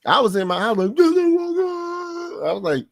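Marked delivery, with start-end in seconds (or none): 0.9-1.9 high, cracking cry of joy at the name; excited shout. Retold or imitated. Fan at home shouting the draft pick name in disbelief. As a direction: yell it like the pick just landed.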